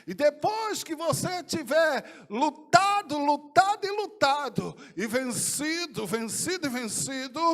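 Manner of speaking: loud, slow preaching shout; forceful proclamation. Retold or imitated. Jesus charging the believer in a vision, shouted proclamation.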